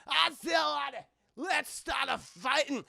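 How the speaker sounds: high, loud Scottish battle cry; enraged Scotsman. Retold or imitated. Scotsman avenging his bagpipes, mock rage. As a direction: imitate a furious Scot starting a fight.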